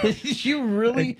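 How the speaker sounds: laughing mock disbelief. Imagined reply to the insult.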